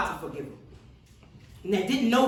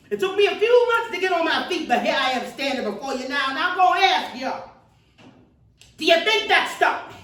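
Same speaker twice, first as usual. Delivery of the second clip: high, loud, rising call to the crowd; a civil rights speaker rousing her audience; preaching at full force; 7 semitones higher, 5 dB louder than usual. Acted. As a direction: build the fire and demand an answer.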